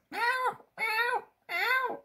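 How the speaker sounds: high mewing cat imitation; cat meow. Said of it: "Mary Jane starts saying".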